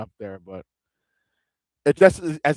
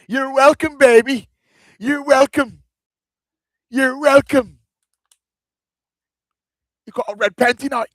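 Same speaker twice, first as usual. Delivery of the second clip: much higher, louder gleeful crowing; hyped shout; 11 semitones higher, 7 dB louder than usual. Pretend. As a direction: crow it out to the viewers. Mock gracious host shout.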